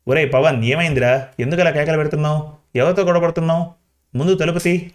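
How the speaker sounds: usual speaking voice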